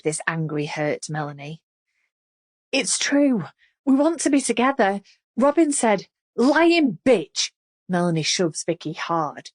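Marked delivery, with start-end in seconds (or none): 2.7-6.1 desperate rush. A narrator's reading. Vicky desperate. 6.4-7.5 furious shout. A narrator's reading. Melanie furious.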